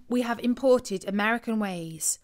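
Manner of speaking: usual speaking voice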